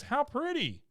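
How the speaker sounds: put-on very high cooing girl; girly voice. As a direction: coo it like a sweet little girl. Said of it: "She says".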